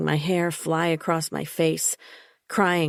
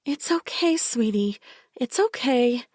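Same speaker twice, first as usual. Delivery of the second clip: high, syrupy coaxing voice; over-sweet mother soothing a child; sickly sweet; 8 semitones higher than usual. A narrator's reading. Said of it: "she said in a voice like thick, sickly sweet syrup".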